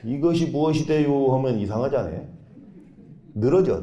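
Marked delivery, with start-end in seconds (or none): 0.1-1.3 충청도 사투리로 늘어지게; Chungcheong dialect. Shown as a demonstration. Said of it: "충청도 말로".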